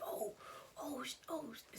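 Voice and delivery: whispered panicky oh; acted-out whisper of dismay; hushed alarm. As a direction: swear under your breath, hushed. Friend sees her on the ground.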